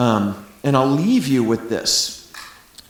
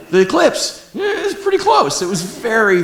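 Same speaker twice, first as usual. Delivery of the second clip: high, louder conspiratorial confession; teasing aside; 11 semitones higher, 6 dB louder than usual. Pretend. Father teasing his daughter who might watch someday, high playful aside. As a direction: let slip the eclipse nearly beat her, playfully.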